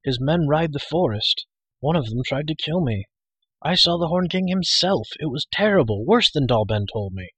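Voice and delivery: high, urgent, agitated report; alarmed youngster's outburst; bursting out. A narrator's reading. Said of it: "Taran burst out".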